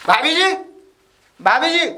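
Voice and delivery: loud, high call with rising end. Acted. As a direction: shout for the neighbour to come out. Calls at neighbour's door.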